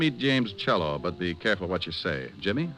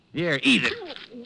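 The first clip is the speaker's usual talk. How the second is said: mocking taunt; 13 semitones higher, much slower than usual. Shoving the check at Sears.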